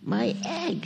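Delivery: high-pitched storybook exclamation without real volume; mild, high acted shout; mock shout. A narrator's reading. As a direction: cry out in amazement as a storyteller would. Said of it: "shouted Horton".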